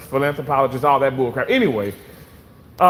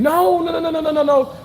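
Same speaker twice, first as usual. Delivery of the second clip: emphatic high denial; 12 semitones higher than usual. Real, not acted. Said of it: "emphatic denial".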